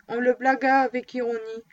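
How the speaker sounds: ordinary delivery, nothing marked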